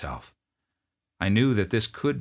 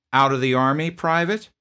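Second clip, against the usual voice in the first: loud, higher, curt demand; drill sergeant; 8 semitones higher, 9 dB louder than usual. Retold or imitated. Drill sergeant confronting a sniffling private, loud bark.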